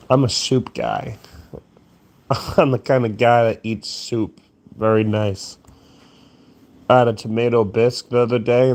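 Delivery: usual speaking voice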